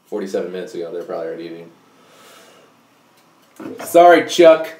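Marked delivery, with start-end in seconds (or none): 3.8-4.7 mock apology. Playful apology to absent friend.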